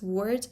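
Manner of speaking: "word" with the R clearly pronounced; rhotic R. Shown as a demonstration. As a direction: sound the R in "word". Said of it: "R at the end of the word".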